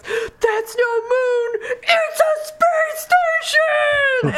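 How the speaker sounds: high, drawn-out movie shout; Philip Seymour Hoffman. Retold or imitated. Quoting a film line as a tornado comes, shouted. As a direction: yell it long and high, stretching every word. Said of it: "the way he says it is so great".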